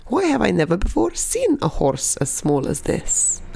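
put-on puzzled Russian-accented granny; lightly voiced bewildered granny; confused old granny.

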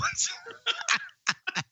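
a laugh that jumps way up high; high squealing laugh. Real, not acted. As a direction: burst into a helpless high-pitched laugh. Cracking up at a movie line.

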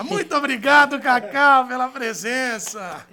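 big loud slow thanks; booming announcer. Acted. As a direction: boom it out like a host.

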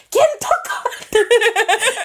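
high, drawn-out mock outcry; imitating mom. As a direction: call out like an accusing mother. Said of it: "and she'd be like".

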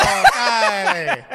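put-on loud, high Italian-accented cry of dismay; mock Italian outburst. Owner learning a star visits while he's away, loud Italian accent. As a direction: blurt it out as the frantic Italian owner. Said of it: "And he put".

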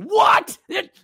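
sudden high, loud "What?"; Hades outburst. Retold or imitated. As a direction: snap like a hot-headed god. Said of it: "acting like Hades from Disney's Hercules".

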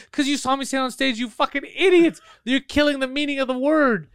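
high, heated angry rant; angry yelling. Retold or imitated. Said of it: "I started yelling".

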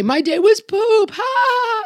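put-on high, slow, gleeful kid voice crowing; mocking kid. Child answering mom at dinner, high gleeful kid voice. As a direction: ham up a cheeky child's gloat. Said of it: "How was your day?".

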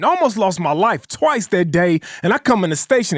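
ordinary talk, nothing marked

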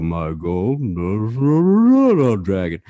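very low, garbled dragon on a worn-out tape; warped tape imitation. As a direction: imitate a badly warped recording of a dragon, dragging and slurred. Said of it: "it had warped so, so bad".

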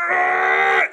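loud, low groan of pain through the needle. Retold or imitated. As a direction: let out a deep retold cry of pain. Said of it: "I was sitting there, I was going".

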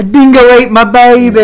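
high, loud hammed-up Australian accent; mock Australian accent. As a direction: blurt the famous dingo line in a joking Australian voice. Quoting famous movie line jokingly.

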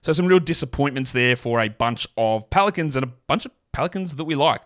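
usual speaking voice

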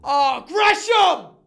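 high drawn-out yell at the screen; screaming at the game. Retold or imitated. Gamer at home yelling at the TV, loud drawn-out shout. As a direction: yell it like the players can hear. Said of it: "I'm screaming at these people".

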